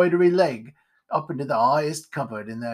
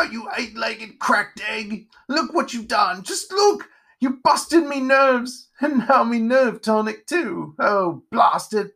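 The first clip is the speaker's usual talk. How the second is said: high, wailing outrage; loud melodramatic character tantrum; hysterical Pumpkinhead; 8 semitones higher, 4 dB louder, slower than usual. Acted. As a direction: wail and rage over a disaster. Pumpkinhead wails over broken tonic.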